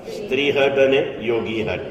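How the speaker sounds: ordinary talk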